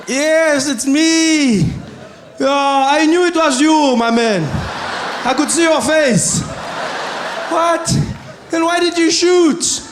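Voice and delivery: high, drawn-out distant shouting; calling out. Acted. Security guard and the shot homeowner chatting, calling out loudly. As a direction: holler across a distance, stretching the words.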